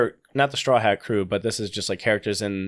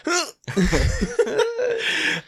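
high giggling fit; helpless giggling at hiccups; hiccup giggles. Real, not acted. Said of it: "Are you laughing at my hiccups?".

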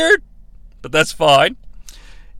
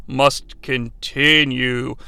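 very slow, low pronouncement; mock ominous; 8 semitones lower, 4 dB quieter, much slower than usual. Recapper declaring the match goes on, slow mock-ominous. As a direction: drag it out like a grave decree.

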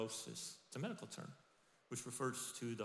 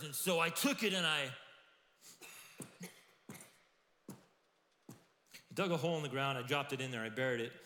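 nervous excuse; 3 semitones higher, 5 dB louder than usual. Retold or imitated. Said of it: "He says".

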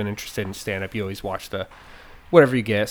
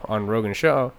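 ordinary talk, nothing marked

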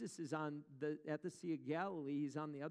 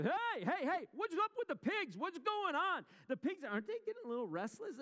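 alarmed farmer, pitched way up and louder; hammed-up farmer, much higher, with a faster last line; pig farmer; 14 semitones higher, 6 dB louder than usual. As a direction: shout across the field at restless pigs. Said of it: "And the farmer".

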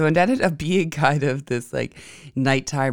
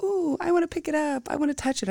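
put-on excited little boy; 14 semitones higher than usual. Son wanting to touch the cockroach, high and eager. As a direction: voice her son's eager curiosity. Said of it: "he's like".

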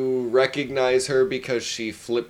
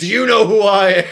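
mock indignant; 7 semitones higher, 8 dB louder, faster than usual. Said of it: "Look at the intensity on my face".

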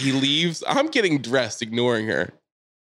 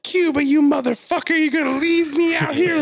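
very high, loud screech; imitated furious yelling; 5 dB louder than usual. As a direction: scream after someone abandoning you, in imitation. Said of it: "watch her yelling at him".

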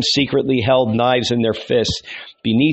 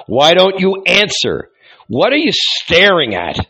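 angry demand; 7 semitones higher, 4 dB louder than usual. A narrator's reading. Angry man grabbing the carpenter.

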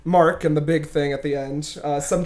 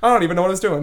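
ordinary delivery

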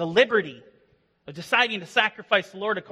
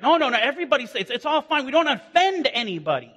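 higher, louder mocking whine of easy tolerance; 7 semitones higher, 7 dB louder, faster than usual. Pastor mocking PC culture, sarcastic imitation. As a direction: parody the everything's fine crowd.

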